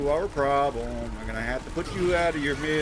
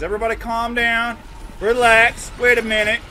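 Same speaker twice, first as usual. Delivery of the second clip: loud pleading; 7 semitones higher, 3 dB louder than usual. Real, not acted. Pleading with attackers.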